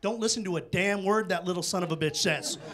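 ordinary talk, nothing marked

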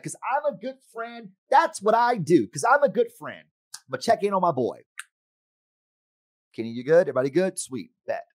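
high, pleased-with-himself voice; mock a friend admiring himself; smug self-praise. Mocking his own smug self.

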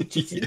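chitin said as CHIT-in; common mispronunciation. Retold or imitated. Auctioneer on what many people say, chitin pronounced CHIT-in. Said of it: "There's a lot of people say chitin".

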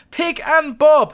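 calling out. Real, not acted. Calling out a viewer's name.

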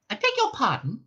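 high, prim mouse query; puzzled mouse voice. A narrator's reading. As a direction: mouse taken aback. Benji puzzled.

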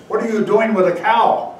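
loud, indignant shout of a villager; gruff retort. Acted. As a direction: bark it out, suspicious and annoyed. Annoyed villager refusing the monks.

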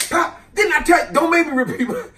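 high, loud scold; scolding parent. Retold or imitated. Parent scolds the kid for asking twice, high and loud. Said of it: "it was".

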